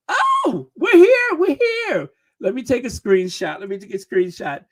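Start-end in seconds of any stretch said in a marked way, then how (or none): 0.1-2.1 high, loud burst of celebration; jubelnd aufgeregt. Real, not acted. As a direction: cheer it out in real excitement. Celebrates reaching thirty thousand subscribers.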